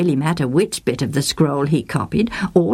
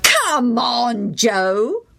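put-on a higher teasing boys' call; boys' taunting call; 6 semitones higher, much slower than usual. A narrator's reading. The boys yelling to Joe again, lightly teasing. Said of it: "they yelled again".

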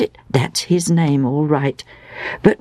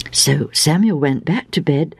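ordinary delivery, nothing marked

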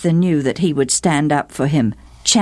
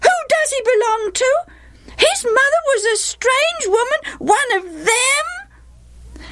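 put-on outraged young boys. A narrator's reading. Half-brothers turn on Jephthah, outraged and high-pitched. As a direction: taunt like scandalised little brothers. Jeering boys reacting to the outburst.

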